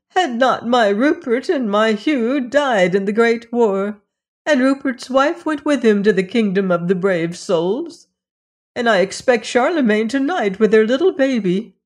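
lightly voiced old woman mourning her sons; old mother grieving. A narrator's reading. Mother Hulda tells of sons.

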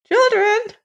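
put-on very high-pitched old woman, astonished; high old-woman voice, surprised; old woman exclaiming. A narrator's reading. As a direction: exclaim it as a startled old mother. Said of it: "exclaimed the old woman".

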